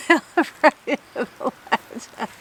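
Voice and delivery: high, slow, laughing cold-lipped words; forcing words through numb lips; shivering. Real, not acted. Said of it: "my lips are too cold".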